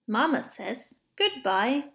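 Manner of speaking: ordinary delivery, nothing marked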